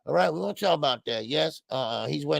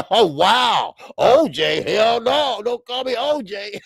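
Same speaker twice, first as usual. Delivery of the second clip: loud, high laughing protest; laughing, shocked at the nickname; surprised outburst; 7 semitones higher, 7 dB louder, slower than usual. Real, not acted.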